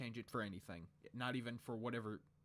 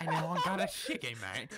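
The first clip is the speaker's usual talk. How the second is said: put-on louder British accent through laughter; mock British accent; 3 semitones higher, 6 dB louder than usual. Joining the British joke in a laughing British accent. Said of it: "British people like".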